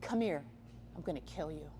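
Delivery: calm, soft-spoken threat voicing the dog; very calmly. Guardian dog calmly warns a predator at the fence, soft menace. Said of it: "walks up to the fence very calmly".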